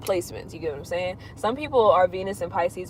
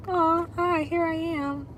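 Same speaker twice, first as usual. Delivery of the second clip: sweet greeting; 11 semitones higher, 4 dB quieter, slower than usual. Real, not acted. Greeting a viewer on stream.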